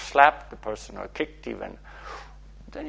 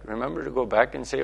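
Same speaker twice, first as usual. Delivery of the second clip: ordinary talk, nothing marked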